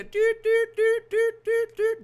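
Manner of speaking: high, loud sung doot doot of a cabin chime; airplane chime. Retold or imitated. Imitating the cabin chime before the captain speaks, high and loud. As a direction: sing out the plane's intercom chime. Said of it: "I don't wanna hear".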